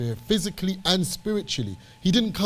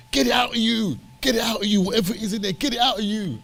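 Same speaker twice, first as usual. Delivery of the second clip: higher-pitched impassioned teacher, banging on; Priest Ricardo; 7 semitones higher than usual. Retold or imitated. Priest banging on to his congregation, impassioned imitation. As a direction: imitate a preacher urging with passion. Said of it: "with passion, I hope he's watching".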